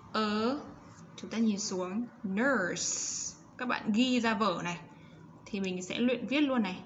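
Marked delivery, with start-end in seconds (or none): none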